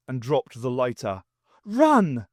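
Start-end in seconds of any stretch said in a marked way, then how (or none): 1.6-2.3 high, loud urgent cry; urgent shout to run. Acted. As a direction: shout the warning. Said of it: "cried the doctor".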